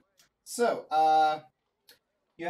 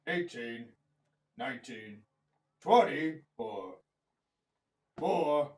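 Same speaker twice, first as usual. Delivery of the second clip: gruff cyclops; 4 semitones lower than usual. Acted. GM voices cyclops counting on fingers.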